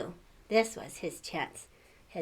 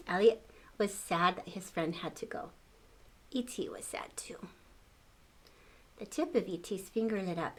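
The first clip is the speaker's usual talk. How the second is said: ordinary delivery, nothing marked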